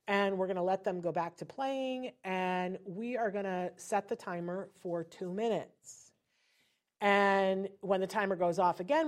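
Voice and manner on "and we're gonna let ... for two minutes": ordinary delivery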